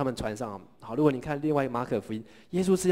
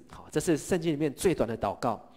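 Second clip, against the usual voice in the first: usual speaking voice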